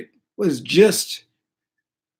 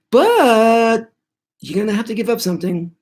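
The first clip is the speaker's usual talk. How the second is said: higher-pitched imitation of a spirit making a deal; spirit's bargaining voice; 9 semitones higher, 3 dB louder than usual. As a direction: voice a spirit naming its price in a raised pitch. Spirit voiced setting a condition.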